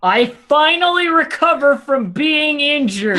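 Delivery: loud, high, drawn-out cry; triumphant shout. Acted. Player announces recovery to the table, triumphant shout. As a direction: belt it out high and slow, in triumph. Player celebrates at their turn.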